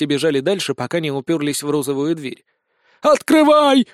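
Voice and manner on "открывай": loud, high-pitched shout; yelling. A narrator's reading. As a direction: yell the order desperately. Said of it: "заорал Сисюля". (громкий крик)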